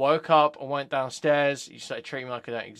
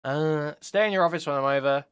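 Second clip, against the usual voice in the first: angry reading; 5 dB louder than usual. Retold or imitated. Reading out abusive messages, louder.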